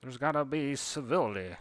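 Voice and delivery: put-on a proud cardinal demanding civility, Southern accent. A cardinal grumbling about blue jays, affronted Southern accent. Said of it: "that's a cardinal talking about blue jays".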